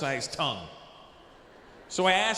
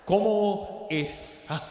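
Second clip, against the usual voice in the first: lisping Spanish; much slower than usual. Retold or imitated. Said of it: "speak Spanish with a lisp".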